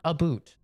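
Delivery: mock Canadian about, said "a boot". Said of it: "They say a boot".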